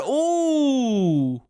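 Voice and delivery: high, drawn-out, loud ooh; long "ooh" sliding down in pitch; excited ooh. Real, not acted. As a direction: coo loudly at an exciting reveal. Reacting to a new photo.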